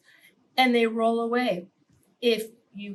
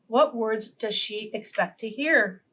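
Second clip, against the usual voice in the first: ordinary delivery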